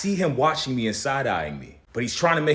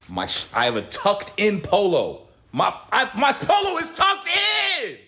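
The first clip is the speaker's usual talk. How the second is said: higher and louder, exasperated; indignant shout; 11 semitones higher, 5 dB louder than usual. Real, not acted. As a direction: shout it out in disbelief. Indignant shout about clothes.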